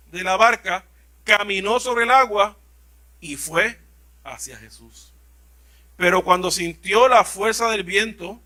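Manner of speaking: ordinary talk, nothing marked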